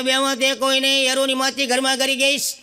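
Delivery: put-on a kid half laughing at his own joke; son on the phone.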